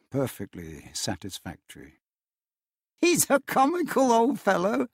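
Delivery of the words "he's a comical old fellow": higher, light chuckling voice; amused nephew. A narrator's reading. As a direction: tease his uncle fondly. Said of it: "said Scrooge's nephew".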